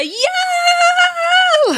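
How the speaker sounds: very high, long mock cheer; drawn-out high-pitched crowd imitation; imitated audience cheer. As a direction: squeal it like a whole crowd cheering. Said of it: "The audience said".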